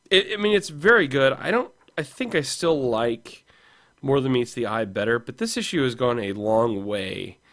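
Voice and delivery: ordinary delivery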